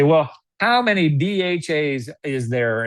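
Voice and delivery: slow, drawn-out Alabama drawl; Alabama accent. Pretend. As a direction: put on a lightly played Alabama accent. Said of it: "now I'm doing Alabama".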